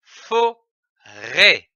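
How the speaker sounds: "forêt" split into fo and rê with a pause; teacher's breakdown of "forêt": fo, then rê; syllable by syllable. Shown as a demonstration. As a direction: sound out "forêt" in two separate syllables, fo - rê. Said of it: "L'association de ces syllabes donne".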